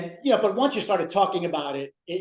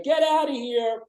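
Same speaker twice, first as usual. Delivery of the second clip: Ralph's line; 7 semitones higher than usual. Retold or imitated. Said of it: "He's like".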